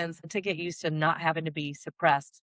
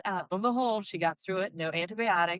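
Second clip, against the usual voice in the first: usual speaking voice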